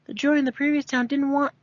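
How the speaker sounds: ordinary delivery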